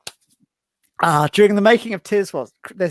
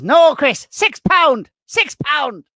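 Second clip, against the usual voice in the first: higher and louder, delighted comic; Carson voice; 10 semitones higher, 5 dB louder, slower than usual. Retold or imitated. Carson boasts to Tarrant about the price, higher and louder. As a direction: crow the bargain price. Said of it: "And Carson says".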